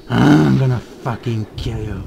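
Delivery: hammed-up angry growl; mock angry. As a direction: growl it like an angry man's threat. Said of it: "angry, he's like".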